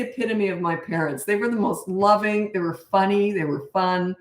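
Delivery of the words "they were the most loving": usual speaking voice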